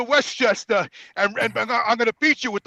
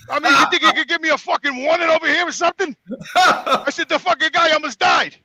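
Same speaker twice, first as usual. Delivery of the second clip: louder, higher exasperated rant; scolding tenant for no warning, louder and higher; retold anger; 6 semitones higher, 6 dB louder than usual. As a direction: let the tenant have it, half-laughing. [name] retells yelling at tenant.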